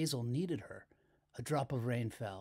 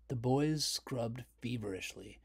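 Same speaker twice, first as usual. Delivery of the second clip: plain reading voice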